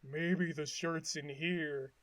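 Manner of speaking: slow, low, quiet musing; Patrick. Retold or imitated. Patrick looking at the seal cage, slow low pondering. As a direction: think aloud softly in a low dopey voice. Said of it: "he thinks".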